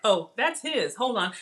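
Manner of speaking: ordinary talk, nothing marked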